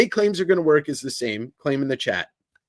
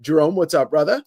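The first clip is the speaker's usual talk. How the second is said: ordinary talk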